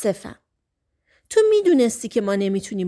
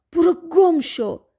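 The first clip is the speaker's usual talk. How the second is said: shout; 8 semitones higher than usual. Acted. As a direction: hurl it at him with all her force. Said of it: "فریاد زد".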